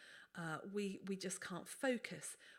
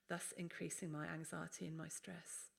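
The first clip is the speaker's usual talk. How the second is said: ordinary talk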